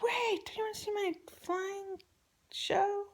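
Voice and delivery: put-on high-pitched, eager child voice; imitated little daughter. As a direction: mimic a kid begging to show off. Voices daughter calling her back.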